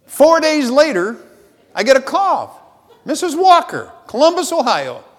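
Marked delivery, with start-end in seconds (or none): 3.0-5.0 caller's voice. Retold or imitated. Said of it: "She says".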